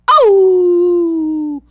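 long loud high howl; dog howl. Acted. The desert dogs cry out, loud high howl.

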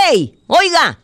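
high, loud scolding yell; angry shout. Acted. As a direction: yell like an angry park ranger. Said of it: "le grita enojado".

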